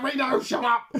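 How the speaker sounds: high, loud annoyed snap; irritated shout. Retold or imitated. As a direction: shout down the noisy one. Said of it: "He's like".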